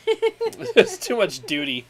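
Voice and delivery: slow, laughing through it; laughing echo. Acted. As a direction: savor the joke while laughing.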